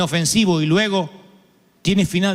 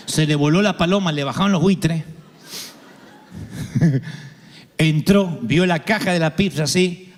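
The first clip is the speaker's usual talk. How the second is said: normal talking voice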